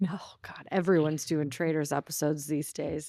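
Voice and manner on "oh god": whisper. Real, not acted. Dismayed aside.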